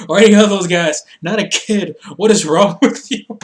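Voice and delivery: loud scolding breaking into a laugh; mock indignation. Imitating a wrestler scolding his crew for hitting a kid, mock indignant. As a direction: scold them loudly, half laughing. Kingston scolding, host amused.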